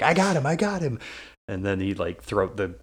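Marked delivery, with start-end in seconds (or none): 0.0-1.0 much higher, louder eager shout; excited. Retold or imitated. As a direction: yell it like a proud sidekick. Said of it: "he's like".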